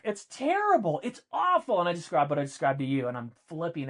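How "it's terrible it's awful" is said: retold outburst. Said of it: "And I was like".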